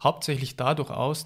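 ordinary talk

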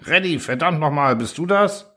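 furious engineer yelling down the line; shouting. A narrator's reading. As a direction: bark it out, cursing. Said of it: "jemand schrie".

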